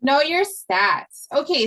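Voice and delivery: usual speaking voice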